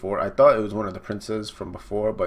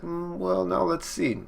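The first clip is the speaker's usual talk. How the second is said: high musing character voice; lightly voiced coy woman; pondering lady; 9 semitones higher than usual. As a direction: muse aloud in a pitched-up feminine voice. Praline voiced pondering a question.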